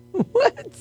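squeaky, high, disbelieving; laughing what. Real, not acted. DJ laughing at the survey, high squeaky disbelief.

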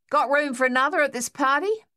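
a gunner joking as he arrives; cheery quip. A narrator's reading. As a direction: toss it off like a joke under fire. Harold joins cheerfully.

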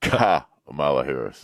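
"Kamala" with the first syllable set apart and stressed. Shown as a demonstration. Co-host shows listeners how to say "Kamala", first syllable stressed. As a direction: lean hard on the "Ka" of "Kamala". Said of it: "the accent is on the first syllable".

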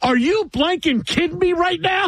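high, shrill mock outrage as the wife; furious wife. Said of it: "she goes".

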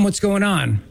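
normal talking voice

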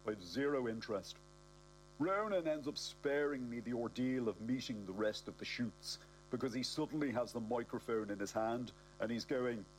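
ordinary delivery, nothing marked

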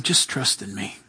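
low, hushed and gentle; still small voice. Retold or imitated. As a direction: murmur it like a quiet reassurance. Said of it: "that still, small voice".

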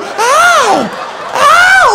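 shrill cries of pain; high pained yelps. Pretend. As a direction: yelp loud and high. Quill stabs, pained cries.

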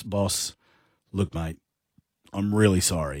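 low Aussie tradie apology; lightly voiced blokey tradie. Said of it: "I love that you use tradie voice".

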